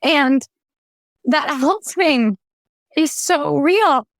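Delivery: holding back tears while telling it; tearing up. Real, not acted. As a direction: voice lifted with real emotion on the edge of crying. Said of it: "I just had a flashback to that".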